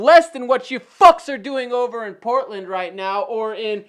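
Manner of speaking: loud angry rant; going off on them, loud and heated. Real, not acted. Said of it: "I got a little heated there".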